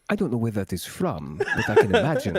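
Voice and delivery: put-on vague Middle European accent, laughing; pseudo-Italian accent. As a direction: put on a fantasy-land Italian lilt. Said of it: "this pseudo-Italian, Middle European thing".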